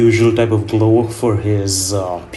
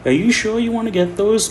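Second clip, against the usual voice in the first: much higher, warning waitress voice; doubtful hostess; 12 semitones higher than usual. Retold or imitated. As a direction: play the worried hostess, high. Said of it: "she was like".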